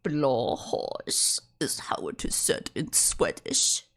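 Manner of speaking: slow, low mock whisper; a spooky mock narrator whisper; creepy whisper. Mocking the creepy video whisper.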